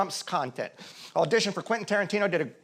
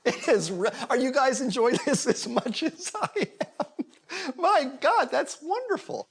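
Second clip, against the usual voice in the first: talking through laughter, pitch jumping higher; helpless laughter; 6 semitones higher than usual. Real, not acted. Acting coach mocking a student's monologue to the class, laughing. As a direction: break up laughing while trying to speak.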